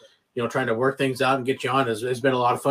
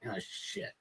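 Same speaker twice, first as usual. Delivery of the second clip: breathy whispered oh shit under the breath; whispered curse; 16 dB quieter than usual. Retold or imitated. Told of the surprise inspection, a whispered curse. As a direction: mutter it voicelessly, caught off guard.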